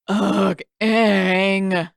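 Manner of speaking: put-on loud, whiny groan of a bored boy; bored brother whining at Aang; complaining. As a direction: drag it out like a bored kid. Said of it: "he complained".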